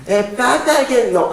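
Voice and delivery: a hint of high childish whine; little daughter's voice. Preacher imitating his little daughter, lightly voiced childish whine. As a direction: lightly voice the small daughter pleading. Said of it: "mi hija pequeña me resulta hablando así".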